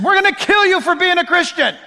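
loud, high mock threat; threatening persecutors. Romans threatening Paul, loud high mock threat. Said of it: "And they said".

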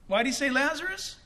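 ordinary talk, nothing marked

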